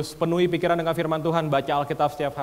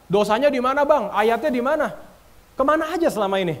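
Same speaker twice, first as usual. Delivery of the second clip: high-pitched, louder mock protest; indignant challenge; 8 semitones higher, 3 dB louder than usual. Preacher mimicking Christians who disputed his post, indignant challenge. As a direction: challenge it in a raised, incredulous voice.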